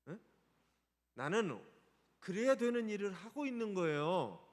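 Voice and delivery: normal talking voice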